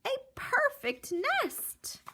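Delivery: very high squeaky goose. Acted. Goose admiring the nest, squeaky high voice.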